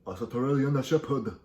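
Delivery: hammed-up Korean accent with extra vowels; mock Korean accent. Shown as a demonstration. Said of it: "you say it with Korean accent".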